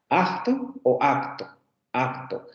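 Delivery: acto said slowly, first with a held vowel, then with a relaxed k; slow acto variants. Shown as a demonstration. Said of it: "En algunas variantes se dice acto".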